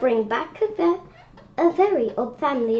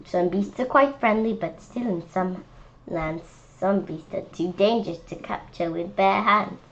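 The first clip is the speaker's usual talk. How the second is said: plain reading voice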